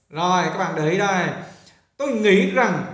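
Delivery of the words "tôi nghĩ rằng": ordinary delivery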